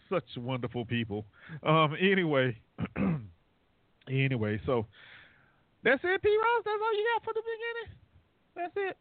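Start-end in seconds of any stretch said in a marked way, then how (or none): 5.8-9.0 much higher, playful teasing. Real, not acted. Host ribbing P Ross for her short intro, high teasing. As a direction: tease her in a high squeaky voice.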